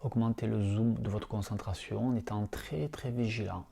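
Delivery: soft, hushed meditation voice, unhurried; guided meditation. Acted. Teacher guiding lying students through yoga nidra, soft meditation voice. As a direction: ease listeners into calm breathing awareness.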